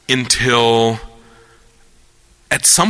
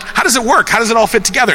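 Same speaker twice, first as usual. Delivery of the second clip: high, insistent amateur questions; pestering questioner; 11 semitones higher, 3 dB louder, much faster than usual. Acted. Layman pressing the physicists, high eager questioning. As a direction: pester the expert, pitched way up. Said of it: "I'm like".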